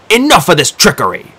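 loud, sharp rebuke; angry outburst. A narrator's reading. Dee breaking the Disirs' cold spell with an angry outburst. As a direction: cut through the trickery with a shout.

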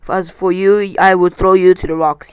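loud threat to the burglar; crying out. A narrator's reading. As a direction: cry the threat out with force. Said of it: "he cried and lifted Bilbo".

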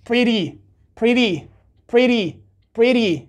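"pretty" with a light tongue tap; American flap T. Shown as a demonstration. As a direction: drill "pretty" as PRIDDY, same fall each time.

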